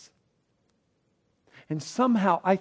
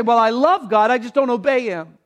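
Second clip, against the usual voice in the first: mock excuse; 6 semitones higher, 9 dB louder than usual. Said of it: "You can't say".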